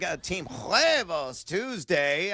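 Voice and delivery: ordinary delivery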